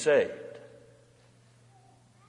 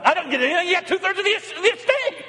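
much higher complaining ungrateful son; mock the son griping about his share; 3 dB louder, faster than usual. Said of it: "Unthankful, unappreciative".

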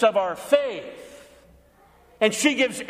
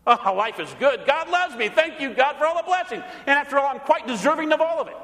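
higher, self-satisfied thanks to God; smug contentment; 7 semitones higher than usual. Retold or imitated. Comfortable believer thanking God, smugly pleased. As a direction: pat yourself on the back as you thank God.

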